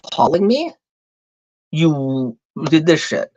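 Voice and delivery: usual speaking voice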